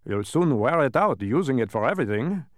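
a pedantic many-faced figure; dodecahedron voice. A narrator's reading.

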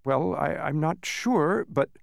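halting, unsure reply; stammering. A narrator's reading. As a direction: stumble over the start, unsure. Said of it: "Milo stammered after several minutes".

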